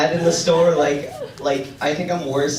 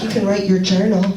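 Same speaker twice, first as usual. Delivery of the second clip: a hint of a lilting, camp mom; light mock of mom's sing-song; lightly camp mom voice; 4 semitones higher, 4 dB louder than usual. Son imitating his mom's camp voice, lightly lilting.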